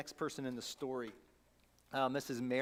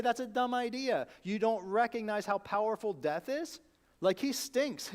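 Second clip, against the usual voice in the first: higher, louder mocking objection; exasperated scoff; 5 semitones higher, 5 dB louder than usual. Believer scoffing at Jesus's command, loud and incredulous. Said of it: "or we say".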